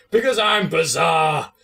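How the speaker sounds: Bulldog catchphrase. Retold or imitated. Quoting a favourite Bulldog line as a slow mock shout. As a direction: bellow the catchphrase like a hammy wrestler. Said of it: "is still a highlight to me".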